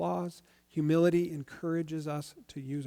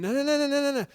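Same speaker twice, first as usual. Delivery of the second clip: mock refusal; 9 semitones higher, 5 dB louder, faster than usual. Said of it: "Paul's not saying".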